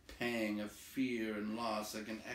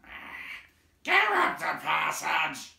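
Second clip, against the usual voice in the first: higher, far louder, slow goblin yell; goblin shout; 8 semitones higher, 13 dB louder, slower than usual. A narrator's reading. Goblins guarding the passage, shouting. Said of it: "some shouted".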